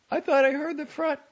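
high, loud mother character voice; high-pitched motherly exclamation; surprised mother. A narrator's reading. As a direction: call it out high, breaking off mid-thought. Mother explains, breaks off.

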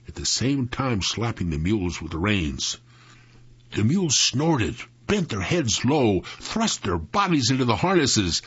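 ordinary delivery, nothing marked

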